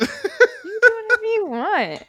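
high, slow, laughing cheerleader voice; high-pitched voice. Pretend. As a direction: push every word way up high. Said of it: "your voice is so high right now".

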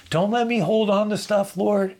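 impassioned high prayer; fervent prayer. Real, not acted. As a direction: cry it up to God. Prayer cried out.

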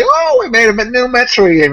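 high-pitched company hype; mock excited announcement. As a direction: announce it with put-on glee. Voicing Nintendo's big announcement.

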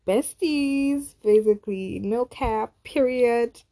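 high, loud Gen Z slang; ironic zoomer. Pretend. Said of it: "That's so cringe".

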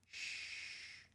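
very soft, long shh; hushing shush. Acted. Iris hiding behind the sofa, long soft shush. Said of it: "and Iris went".

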